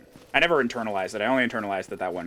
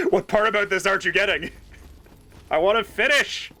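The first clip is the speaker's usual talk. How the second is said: mock indignant; 9 semitones higher, 4 dB louder than usual. Pretend outrage at chat.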